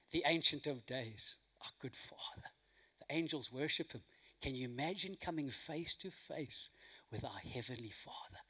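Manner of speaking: quiet reverent whisper before God; hushed awe. Real, not acted. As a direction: hush it as if in holy presence.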